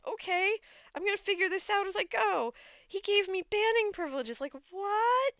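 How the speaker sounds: high, loud, bubbling retelling; giddy excitement. Real, not acted. Fan recounting mod promotion, high giddy excitement. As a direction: tell it still buzzing with delight.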